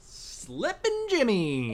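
very slow, high-pitched playful cheer; drawn-out nickname call. Pretend. Co-host chiming in with the con man's nickname, drawn-out call. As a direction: stretch the name out like a catchphrase. Calls out the character's old nickname.

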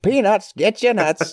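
high ballpark peanut call; hawking peanuts to the stands; stadium vendor hawking. Pretend. Echoing the hawker joke.

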